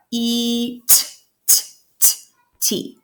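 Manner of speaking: segmenting each sound slowly, apart; sounding out letters. Shown as a demonstration. Teacher shows parents how kids sound out eat. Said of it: "Then they're gonna punch it out".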